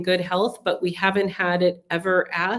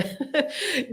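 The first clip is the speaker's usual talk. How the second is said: laugh; 8 semitones higher, 5 dB quieter than usual. Real, not acted. Laughs at cannabis question after cold joke.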